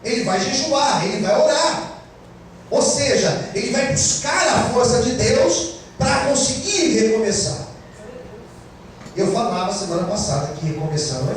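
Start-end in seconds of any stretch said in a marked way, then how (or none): 0.0-7.7 raised, fervent preacher voice; preaching. Acted. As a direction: preach it out to the church. Sermon preaching at full force. (pregação fervorosa)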